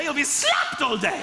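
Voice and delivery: ordinary talk, nothing marked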